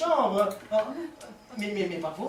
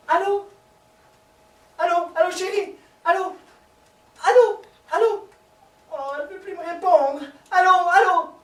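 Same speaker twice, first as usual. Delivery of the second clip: anxious calling out; 10 semitones higher, 9 dB louder than usual. Acted. Line goes dead, calling out.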